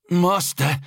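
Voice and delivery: high-pitched subordinate's report; high, strained-up servant voice; anxious officer reporting. A narrator's reading. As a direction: report to a feared master, voice pitched right up. Frightened officer addresses the Exalted.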